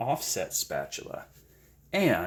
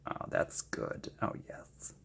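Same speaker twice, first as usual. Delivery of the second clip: whisper; 13 dB quieter, slower than usual. Real, not acted. Quiet delight at the paint.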